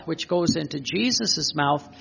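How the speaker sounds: ordinary talk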